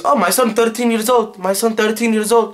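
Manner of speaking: mild mock-dad announcement; lightly voiced dad. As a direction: insist to the police like a worried parent. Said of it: "my dad automatically tells the police".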